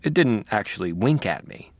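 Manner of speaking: ordinary delivery, nothing marked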